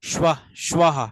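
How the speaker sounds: স্বাহা sounded out as "shva", then "shva-ha"; teacher modelling স্বাহা with a clear "shv"; pronunciation demo. Shown as a demonstration. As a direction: build স্বাহা up in parts, shva, shva-ha. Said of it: "তালব্যস্য ব যেটা".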